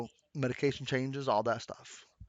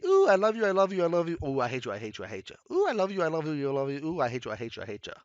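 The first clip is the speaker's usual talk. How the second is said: mood swings; faster than usual. Retold or imitated. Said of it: "mood swings".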